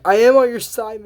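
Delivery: high, loud mock protest; hammed-up insistence; acted protest. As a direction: plead loyalty loudly, as a retold quote. Acted protest to Ivy's accusation.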